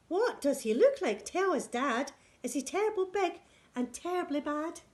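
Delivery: high, excited little voice; eager child question. Acted. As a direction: ask Dad breathlessly, wide-eyed. Child asks Dad about mouse.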